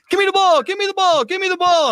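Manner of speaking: high-pitched, mock clamoring fans; imitated crowd yelling. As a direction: pester in a high, repeated mock yell. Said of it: "everybody's yelling at me".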